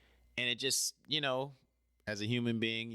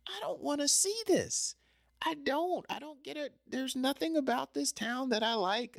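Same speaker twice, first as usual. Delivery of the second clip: high-pitched, fed-up; high exasperated. Retold or imitated. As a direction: squeal your frustration. Venting to coworker about LA.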